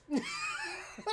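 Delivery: high whooping cackle; shrieking laugh. Real, not acted. Laughing at teenage drama, in a high squealing cackle.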